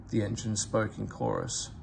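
ordinary delivery, nothing marked

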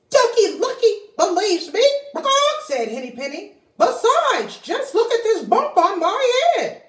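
high, fretful hen voice, tail word squawked; fussing anxious little hen; worried hen. Acted. Henny Penny fretting to Ducky Lucky about the weather, high and fussy.

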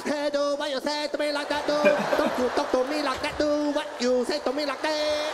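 mock Vietnamese accent, as if on cocaine; hyped-up Vietnamese accent impression; wired Vietnamese accent. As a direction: challenge someone in rapid caricatured Vietnamese-accented bursts. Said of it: "sound like they've been doing cocaine".

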